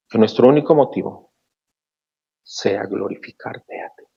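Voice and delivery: fervent spoken prayer, rising on each plea; closing prayer. Acted. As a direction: pray to God on behalf of the church. Pastor prays to close.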